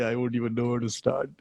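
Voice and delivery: ordinary delivery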